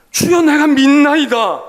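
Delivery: loud, slow cry to the Lord; fervent cry. Acted. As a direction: proclaim it slowly and loudly. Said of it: "어떤 성경은". (크고 느린 간절한 외침)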